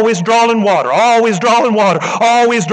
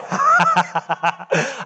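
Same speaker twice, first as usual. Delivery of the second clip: soft laugh; 2 semitones lower, 5 dB quieter than usual. Real, not acted. Preacher laughs mid-sermon.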